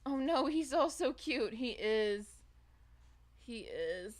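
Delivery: ordinary talk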